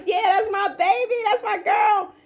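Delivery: hammed-up lovey gushing; gushing celebration. Couple celebrating, smacking kisses.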